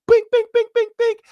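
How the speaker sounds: very high, sharp falsetto pings; imitated metal banging. Imitating knife smacked on cell window, metal banging. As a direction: ring out repeated metallic clinks. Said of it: "like someone smacking metal on a window".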